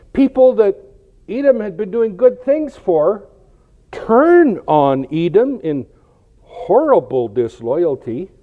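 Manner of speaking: high, slow, weighty delivery; drawn-out words of indignation; emphatic preaching. Real, not acted. Preaches the betrayal of Edom.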